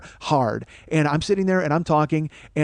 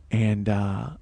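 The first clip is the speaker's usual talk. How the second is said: drawn-out low quiet delivery; quiet, ominous slow draw; slow, deliberate; 6 semitones lower, 6 dB quieter, much slower than usual. Real, not acted. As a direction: stretch every word out slowly, low and soft. Slow emphatic storytelling punchline.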